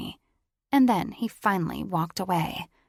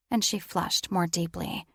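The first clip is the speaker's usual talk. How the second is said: ordinary delivery, nothing marked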